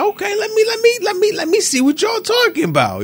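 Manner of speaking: high-pitched, acted-out curiosity; eager stammer, itching to check it out; intrigued eagerness. Acts out an intrigued customer's reaction.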